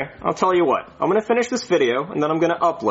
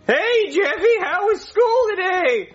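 Marvin voice; 12 semitones higher, slower than usual. Pretend. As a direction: shout it as a goofy dad. [name] voicing Marvin in video.

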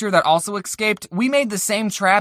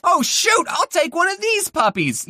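excited mock gush; 10 semitones higher than usual. Excited shopper joke.

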